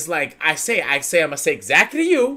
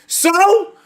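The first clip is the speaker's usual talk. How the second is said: defiant so; 13 semitones higher, 5 dB louder than usual. Retold or imitated. Defiant reply in court.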